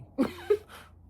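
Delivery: squealing burst of laughter; high laugh. Real, not acted. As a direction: laugh in delighted surprise. Laugh at seeing herself.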